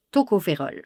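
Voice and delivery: usual speaking voice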